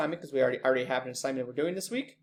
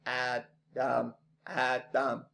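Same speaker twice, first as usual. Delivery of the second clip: "Adam" split slowly into two beats, Ad - am; syllable by syllable; 3 semitones lower, 5 dB quieter, much slower than usual. Shown as a demonstration. Teacher showing the class how syllables work, "Adam" broken apart. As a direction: say "Adam" one syllable at a time, pausing between. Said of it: "every time you go like this".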